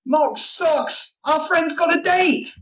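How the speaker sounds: high-pitched gleeful announcement; excited. Acted. Dawn tells Mox their friend has a date, excited.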